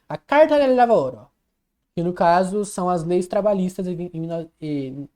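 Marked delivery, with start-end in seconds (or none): 0.1-1.3 "A Carta del Lavoro" in a louder, hammed-up Italian accent. Shown as a demonstration. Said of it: "deixa eu falar com o sotaque italiano".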